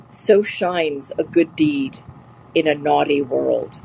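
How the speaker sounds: slow, weighty recitation of a line; quote recital. Shown as a demonstration. Teacher reciting Portia's line to the class, slow and weighty. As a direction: savour each word of the quote. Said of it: "the quote was".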